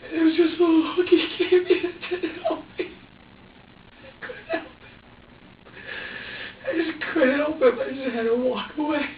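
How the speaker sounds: high voice near breaking with distress; upset, near tears. Real, not acted. A mother recalling walking away from her baby, near tears. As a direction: fight back tears while telling it.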